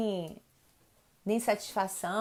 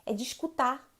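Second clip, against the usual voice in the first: normal talking voice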